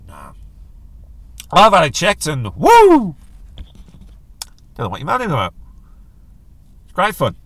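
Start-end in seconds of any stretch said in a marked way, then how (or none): 0.1-0.3 mock reluctance. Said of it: "all men are like". 2.6-3.1 loud, high "woo!"; hammed-up shriek of delight; gleeful whoop. Mock delight at being checked.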